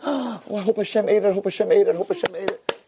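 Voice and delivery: hushed gasp, then eager repeated hoping; acted giddy anticipation; excited. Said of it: "He's all excited".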